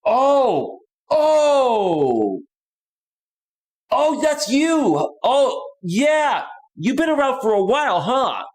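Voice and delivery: high, drawn-out "oh" of sudden recognition; delighted surprise. Real, not acted. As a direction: pitch jumps up as he recognizes the viewer. Recognizing an old viewer, delighted.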